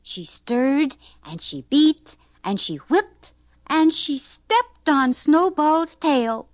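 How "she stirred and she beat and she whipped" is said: ordinary delivery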